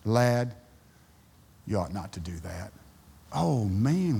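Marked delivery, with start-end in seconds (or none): 0.0-2.7 much softer and lower, a gentle warning; quiet, kind rebuke from a neighbor. Retold or imitated. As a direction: correct him kindly, barely above a murmur. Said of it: "they use the word lad".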